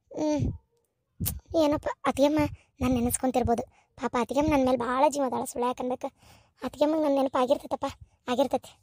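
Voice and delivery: brooding alone, barely aloud; worried inner monologue. Acted. Lonely woman talks to herself. (ಒಂಟಿಯಾಗಿ ತನಗೆ ತಾನೇ ಮೆಲ್ಲಗೆ ಚಿಂತಿಸುವುದು)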